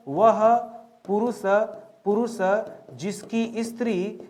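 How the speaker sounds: halting, a pause after each word; word-by-word dictation. Shown as a demonstration. Teacher dictates a definition to students, word by word. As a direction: dole out the definition in separate pieces.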